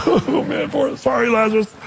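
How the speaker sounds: hammed-up sobbing lament, pitch jumping up; mock crying. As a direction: imitate blubbering over a friend. Acts Jesus weeping over Lazarus.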